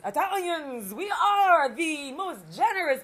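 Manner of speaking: slow, high and loud pompous proclamation; boastful customer. Retold or imitated. Customer bragging to the dancer, loud pompous drawl. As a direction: stretch it out like a self-important patron. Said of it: "He says".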